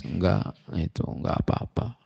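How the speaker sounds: whispered, low, brushing it off; a weeping listener waves the question away under his breath; hushed denial. Retold or imitated. Crying congregant answers the sheikh, whispered.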